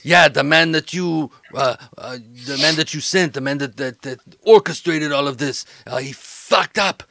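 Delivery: rattled henchman reporting a botched job; stammering, cursing exasperation, bursts of volume; agitated, stumbling bad-news report; agitated criminal associate.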